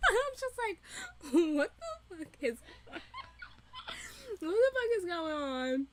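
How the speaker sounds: giggling, stunned question; laughing disbelief. Retold or imitated. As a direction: laugh your way through the confusion. Laughing while retelling shock.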